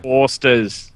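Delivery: mock Aussie brand-name drop; Australian accent attempt. Shown as a demonstration. Co-streamer chipping into the accent game, mock Australian. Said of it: "in Australian".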